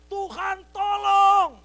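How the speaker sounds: high, long drawn-out cry for help; desperate shout. Acted. Falling man calls to God for help, shouted. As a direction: yell to heaven as you fall. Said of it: "Dia teriak".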